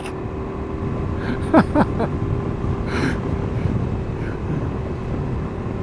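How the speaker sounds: long high unvoiced laughter fading out; real laugh. Real, not acted. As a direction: crack up at the kangaroo. Laughs at kangaroo picture.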